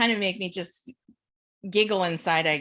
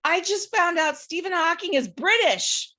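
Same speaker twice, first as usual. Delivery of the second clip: excited husband; 8 semitones higher, 4 dB louder than usual. Retold or imitated. Husband excitedly announces discovery.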